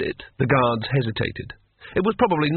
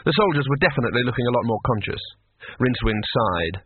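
ordinary delivery, nothing marked

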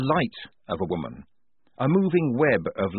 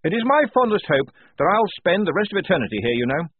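high, loud, eager officer; fervent; 8 semitones higher, 5 dB louder than usual. A narrator's reading. Lieutenant confiding his hope to his corporal, fervent. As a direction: declare the fond hope with keen pride.

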